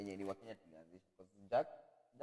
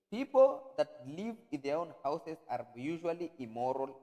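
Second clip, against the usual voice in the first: emphatic retold quote; 7 semitones higher, 12 dB louder, slower than usual.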